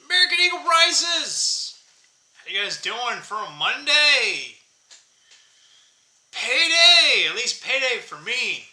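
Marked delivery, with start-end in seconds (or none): 0.1-1.8 high, loud, fast hype intro; excited greeting. Real, not acted. Vlogger greeting his viewers with a big hyped shout. 6.3-7.3 excited cheer. Real, not acted. Celebrating payday.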